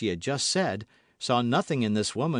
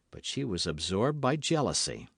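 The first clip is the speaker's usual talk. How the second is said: plain reading voice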